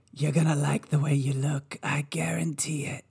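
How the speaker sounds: husky, fading ad sign-off; rasped TV-spot guarantee; commercial pitchman. Retold or imitated. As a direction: imitate a suit-store pitchman, trailing into a whisper. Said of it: "It does sound like the men's warehouse suit guy".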